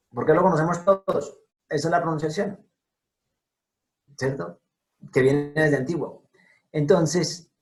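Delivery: usual speaking voice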